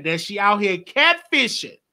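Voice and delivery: ordinary talk, nothing marked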